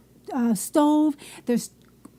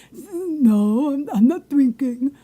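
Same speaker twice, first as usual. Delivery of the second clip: mock slurred denial of drinking; imitating drunk boyfriend; much slower than usual. Imitating her drunk boyfriend Neil denying he is drinking, mock slurred. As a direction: play him caught out but denying it. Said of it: "Like that".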